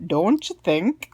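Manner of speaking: slow, drawn-out Minnesotan "Don't you think?"; Minnesotan accent. Shown as a demonstration. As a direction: lean into the Minnesota vowels. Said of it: "my Minnesotan accent".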